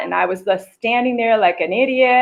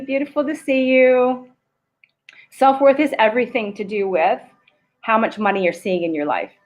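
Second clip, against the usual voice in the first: ordinary talk, nothing marked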